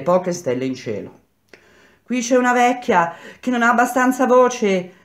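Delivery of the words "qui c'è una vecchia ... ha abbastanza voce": high raised calling voice; woman shouting. A narrator's reading. Wife relaying for an old woman, shouting. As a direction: call out on another's behalf. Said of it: "tornò a gridare".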